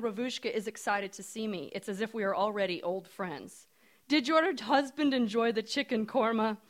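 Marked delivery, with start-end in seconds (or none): 4.1-6.6 put-on hopeful, loud Indian accent; strong Indian accent. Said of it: "she says in her strong Indian accent".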